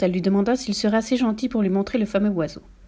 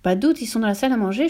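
plain reading voice

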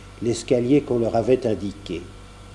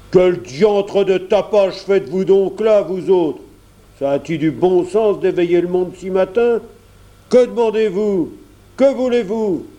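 high, loud grumpy porter; irate, raised-voice questioning; angry scolding; 7 semitones higher, 5 dB louder than usual. A narrator's reading. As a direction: scold the noisy callers who woke you. Porter scolds noisy early callers.

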